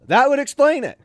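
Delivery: high, loud mock-sergeant reply; imitated drill sergeant. Sergeant answering recruit about twin brother, loud imitated realization.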